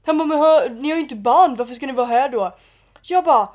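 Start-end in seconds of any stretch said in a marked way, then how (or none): 0.3-2.5 retold pushy challenge. Acts out guy challenging her.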